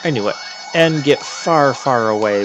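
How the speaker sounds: normal talking voice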